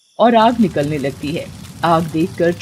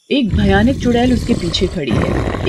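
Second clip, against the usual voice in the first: ordinary delivery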